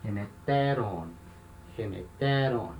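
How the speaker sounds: low, measured "Remeteron", weight on TE; accent shifted one syllable. Shown as a demonstration. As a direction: stress "Remeteron" on TE, long final ON. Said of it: "acento ando uma casa".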